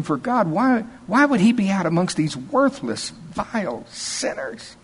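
high-pitched, snooty mock-elite voice; disdainful Pharisee. Uppity Pharisees looking down on worthless sinners, with mocking disdain. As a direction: put on a high, self-important holy-man voice full of disdain. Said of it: "Can't you just hear the disdain in their voice?".

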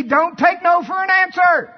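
high, loud exhortation; impassioned preacher's shout; full-force shout. Acted. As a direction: yell it at the top of your voice.

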